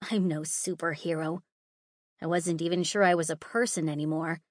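ordinary delivery

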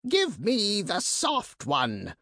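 high, slow rasping monster voice; rasping creature. Acted. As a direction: rasp it out like a greedy bird beast. Monster demands the priestess.

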